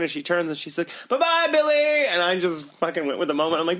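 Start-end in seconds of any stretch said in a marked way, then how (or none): 1.1-2.1 high, sing-out goodbye; imitated farewell call. Old woman calling to her supposed grandson, high imitated farewell.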